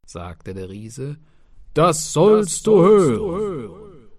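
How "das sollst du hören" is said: slow, booming giant voice. Acted. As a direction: lay it out like a big slow giant. Giant speaking.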